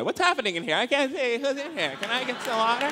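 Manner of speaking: high puzzled voice rising as it goes. Retold or imitated. Comic voicing a confused audience member, baffled and rising. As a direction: act baffled, searching for the voice.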